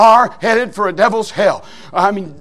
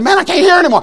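exclaiming; 9 semitones higher, 4 dB louder, faster than usual. Retold or imitated. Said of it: "I said".

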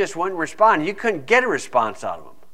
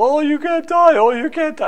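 high, wailing mock panic; mock hysterical neighbor; 12 semitones higher, 3 dB louder than usual. Preacher mimicking the neighbor lady's hysterics at the house, high and wailing. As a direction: imitate a frantic neighbor lady, pitched way up. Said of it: "she's just hysterical".

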